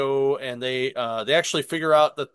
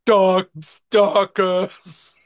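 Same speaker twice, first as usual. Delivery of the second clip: loud, very slow, drawn-out mock voice; exaggerated mimicry, higher and stretched; mocking impression of cohost; 5 semitones higher, 7 dB louder, much slower than usual. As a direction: tease a cohost by booming it out slowly. Teasing cohost's botched pronunciation.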